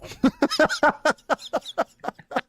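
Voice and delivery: long high-pitched laugh; laughing hard. Real, not acted. Co-host hears the sarcastic hype for the band, long high laugh.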